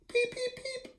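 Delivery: tiny squeaky chick peeps, very high and soft; baby chick peeping. Acted. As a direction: peep like a newborn chick inside its egg. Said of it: "answered the egg".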